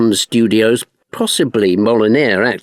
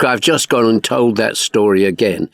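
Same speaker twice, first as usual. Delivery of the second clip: normal talking voice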